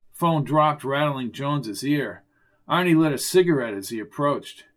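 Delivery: ordinary delivery, nothing marked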